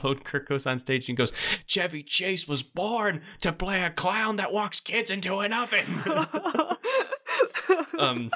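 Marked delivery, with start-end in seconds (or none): 1.7-5.8 high, slow, hammed-up announcer voice, laughing at the end; mock stage announcement. Bob Odenkirk opening the show on stage, hammed-up announcer. 5.9-7.9 cracking up at the joke; high cackling laugh. Real, not acted. Laughing at the Chevy Chase joke, high cackle.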